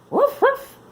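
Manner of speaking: high, loud yappy barks; puppy barks. Acted. Lucky barking as he catches Billy. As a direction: bark like a playful pup. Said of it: "barked Lucky".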